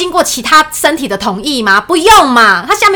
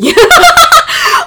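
a long high held laugh, louder; 6 dB louder than usual. Real, not acted. She laughs at her own story about her weak partner, loud high laugh.

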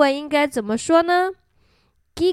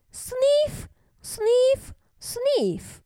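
high-pitched, little sniffs; each word rises high, last one falls; sniffing sound words; 11 semitones higher, 4 dB quieter, much slower than usual. A narrator's reading. Grumpy bear sniffing Joe's fern, high sniffing words.

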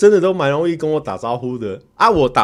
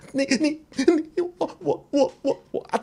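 high stutter fading to a breathy gasp; stammer, lose the words entirely; flustered fan choking on what to say; mock stammering fan; 13 semitones higher, 4 dB quieter than usual. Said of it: "他就开始".

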